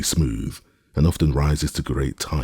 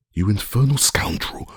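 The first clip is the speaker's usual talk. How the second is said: relieved outburst; 7 semitones higher than usual. A narrator's reading. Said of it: "cried Legrand, apparently much relieved".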